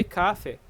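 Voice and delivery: "café" with the first syllable punched; stress on first syllable. Shown as a demonstration. Teacher explaining the mistake, "café" stressed on the first syllable. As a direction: put the weight on the "ca" of "café". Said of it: "putting the stress here in the first syllable".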